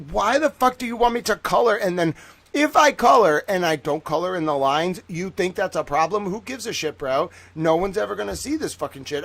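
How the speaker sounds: mildly hammed-up indignant rant; loud start, easing into exasperation; retold anger. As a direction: replay a kid's fed-up protest. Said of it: "I used to get so mad".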